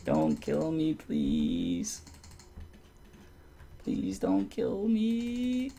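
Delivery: high, slow whimpering plea; terrified horror victim. Pretend. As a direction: beg for your life, drawn out and high. Near death in boss fight.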